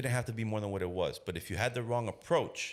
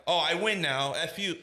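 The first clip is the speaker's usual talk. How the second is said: loud, high triumphant taunt; mock gloating; 9 semitones higher, 5 dB louder, slower than usual. Cop gloating at the father, mock triumph. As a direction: crow over the win in a hammed-up voice.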